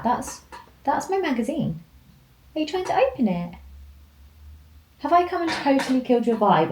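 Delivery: gently scolding the dog for chewing the magazine. Real, not acted. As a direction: tease the puppy fondly.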